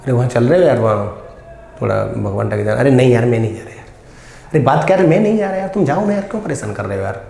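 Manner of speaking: a lightly acted, irritated refusal; mild mock annoyance at being pestered; acted annoyed friend. Shown as a demonstration. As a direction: play a fed-up friend who won't go out. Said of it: "एक expression देता हूँ".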